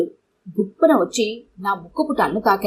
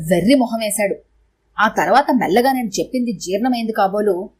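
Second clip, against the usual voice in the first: plain reading voice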